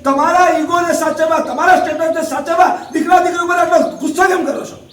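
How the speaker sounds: preacher shouting at parents about their ego; scolding shout. Real, not acted. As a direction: raise the voice and confront them.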